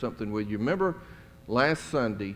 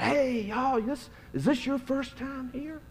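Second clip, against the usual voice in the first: high, bright-eyed bubbly welcome; bubbly greeter; 12 semitones higher, 3 dB quieter than usual. Retold or imitated. Campus greeter welcoming newcomers, high bubbly voice. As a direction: squeal it like an overeager greeter. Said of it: "he just came up just so bubbly".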